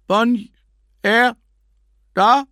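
loud high shouting into phone; shouting on bad phone line. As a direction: yell over a crackling line.

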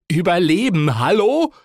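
high, loud and indignant; exasperated shout. A narrator's reading. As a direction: yell it in disbelief at her. Exasperated nephew snaps at aunt.

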